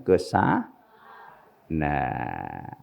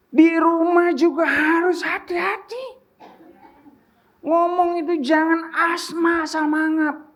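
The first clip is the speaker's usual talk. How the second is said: fiery sermon voice; full-force preaching; 4 dB louder than usual. Acted. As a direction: preach it out to the congregation.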